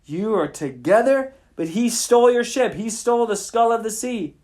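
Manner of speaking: outraged disbelief at the betrayal; hammed-up angry pirate. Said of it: "And Clubs is angry".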